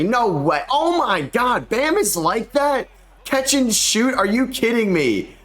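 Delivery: can't believe the big man hit that; stunned disbelief. Real, not acted. Gamer watching Bam drain a three, stunned disbelief. Opponent center hits three.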